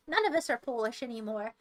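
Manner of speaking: ordinary delivery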